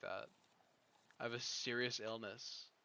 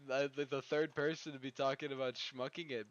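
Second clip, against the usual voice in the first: ordinary talk, nothing marked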